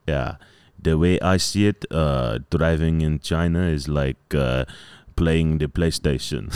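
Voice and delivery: put-on low, measured French accent. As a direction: imitate a Frenchman explaining, with halting pauses. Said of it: "the Hangzhou president's French guy".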